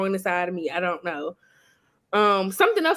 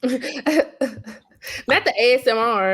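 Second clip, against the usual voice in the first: laughing; much slower than usual. Real, not acted. Laughing at friend's ASMR mention.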